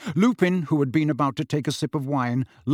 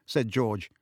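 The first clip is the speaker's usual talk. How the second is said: ordinary delivery, nothing marked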